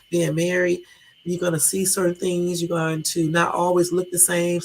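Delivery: ordinary talk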